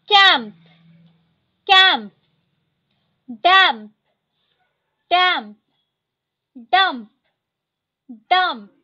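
each word said twice, loud and crisp; teacher drilling vocabulary, clear and loud; word drill reading. Shown as a demonstration. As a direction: read the list aloud for the class to repeat. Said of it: "मैं line by line ऐसे पढ़ते जाऊंगी".

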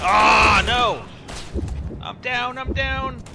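loud high alarmed yell; panicked shout. Real, not acted. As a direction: cry out as you go down. Character downed in game.

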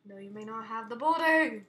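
loud playful refusal; mock-defiant shout. Gamer refusing the giant chasing him, mock-defiant shout. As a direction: build to a shout on booty.

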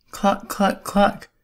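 high clucking hen noises, well above the narration. A narrator's reading. Hen rushing up to the truck, high clucking. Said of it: "Hen came running".

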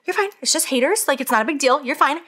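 gentle pep talk to herself; self-reassuring. Retold or imitated. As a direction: coax yourself: you're fine. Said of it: "I was like".

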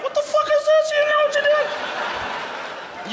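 very high-pitched panicky squeal; mock alarm. Imagined outburst at the doctor.

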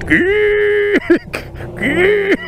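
high, drawn-out mock yell, repeated; retold heckler's yell. Cable station replaying the friend's heckle, high mock yell. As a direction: imitate the heckle as a replayed clip.

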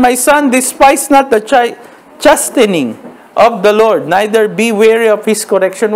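slow, deliberate Bible reading; scripture reading. Acted. Pastor slowly reading the verse to the congregation. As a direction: lift the verse out slowly, word by word.

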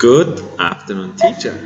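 slow, spaced-out greeting for repeating; slow modelling. Shown as a demonstration. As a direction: leave room between the words for a learner. Models greeting again for pupil. (สาธิตคำทักทายช้าๆ เว้นทีละคำ)

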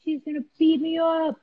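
put-on high-pitched awestruck kid voice; mock scared boys. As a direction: imitate boys spooked by a tough girl. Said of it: "were they scared of you".

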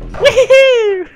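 very high, loud whoop. Real, not acted. Playing a game with a friend, high loud whoop. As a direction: squeal out a cheer. Cheering while climbing in game.

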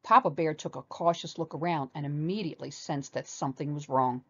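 plain reading voice

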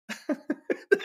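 breathy giggle under the breath; high unvoiced laugh. Real, not acted. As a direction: snicker at the idea. Laughing at the absurd idea.

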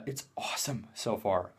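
hushed excited whisper. Real, not acted. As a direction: breathe it out secretly. Whispered aside.

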